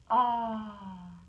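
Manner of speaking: long falling "ah" sigh; refreshed "ah". Shown as a demonstration. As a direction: let out a long, satisfied "ah". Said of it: "'cause he's saying".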